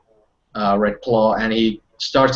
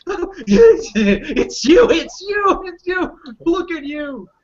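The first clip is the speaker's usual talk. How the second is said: overjoyed; slower than usual. Acted. Reunion with long-lost packmate.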